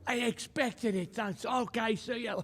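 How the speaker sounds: light, airy, high and soft; breezy brush-off. Retold or imitated. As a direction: toss it off like nothing happened. Casual dismissive retold reply.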